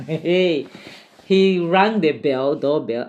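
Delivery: ordinary talk, nothing marked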